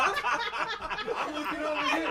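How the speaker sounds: high giggly voice through laughter; laughing. Real, not acted. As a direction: talk while cracking up. Joking while laughing hard.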